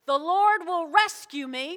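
slow, loud call for a crowd to repeat; loud declaration. Shown as a demonstration. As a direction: lead the congregation, projecting every word. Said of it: "Say it so loud".

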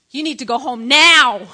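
loud order landing hard on the last word; yelling. Retold or imitated. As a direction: bark it at the visitor, building to "now". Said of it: "She's yelling at me".

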